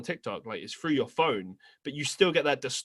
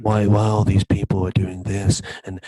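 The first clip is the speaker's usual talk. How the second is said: Leafy's close-mic voice; 4 semitones lower, 6 dB louder than usual. Retold or imitated. Cohost imitates Leafy's loud close-mic voice to his friend. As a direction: drone right into the listener's ear. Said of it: "his voice would be like this".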